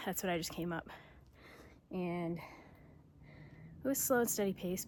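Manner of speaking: usual speaking voice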